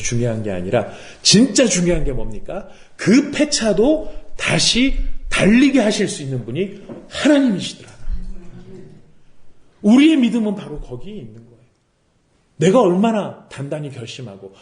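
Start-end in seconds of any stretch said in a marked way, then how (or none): none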